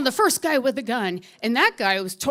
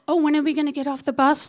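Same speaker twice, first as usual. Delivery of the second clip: higher, louder, rapid mimicking; mocking echo; 4 semitones higher, 4 dB louder, faster than usual. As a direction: throw her question back at her mockingly. Driver mockingly repeats her question.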